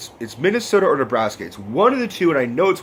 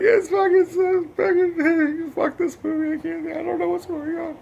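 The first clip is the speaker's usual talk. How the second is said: mock blubbering. Imitating himself sobbing at the movie, high wailing. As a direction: wail it out like a crying kid, then trail off. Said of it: "And I'm like".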